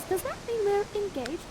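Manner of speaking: put-on high girlish question; Miranda. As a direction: ask coyly, high and light. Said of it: "And then Miranda says".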